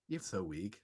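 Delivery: very soft, low ghostly aside; mock ghost disdain. Ghosts watching someone struggle, soft low mock disdain. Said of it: "all the ghosts are just standing there like".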